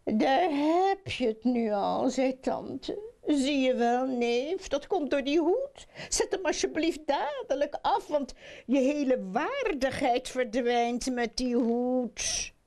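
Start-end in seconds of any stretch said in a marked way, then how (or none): 0.0-2.1 high, lightly voiced fussing; mild told-you-so reproach; scolding aunt. A narrator's reading. Aunt scolds her merry nephew. 3.3-12.5 high, fussy reproach; agitated aunt, pitched up; scolding aunt. A narrator's reading. Aunt scolds her merry nephew.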